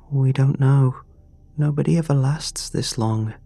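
put-on high, small, frightened girl; young girl voice. A narrator's reading. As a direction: voice a scared little girl. Frightened girl warns the teacher.

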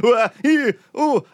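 startled high yelps; panicked yelps. Retold or imitated. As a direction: yelp like a car nearly hit you. Panicked pedestrian dodging cars.

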